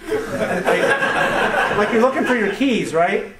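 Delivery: ordinary talk, nothing marked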